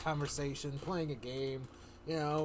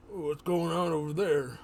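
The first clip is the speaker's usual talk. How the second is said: louder, jaw-jutting orc voice; 4 semitones higher, 8 dB louder than usual. Acted. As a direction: jut the jaw and boom it like an orc. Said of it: "I'll jet the jaw".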